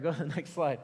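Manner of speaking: normal talking voice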